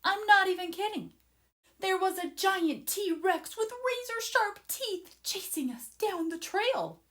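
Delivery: breathless excitement. A narrator's reading. Leo telling listeners about the T-Rex, excited. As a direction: tell it like the T-Rex is still behind you.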